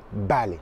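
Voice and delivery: "Balai" as Spanish vale with a full b; B initial net. Shown as a demonstration. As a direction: say "Balai" low and soft, lips closing on b. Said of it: "c'est clairement un B". (« Balai » pour « vale », b bien fermé)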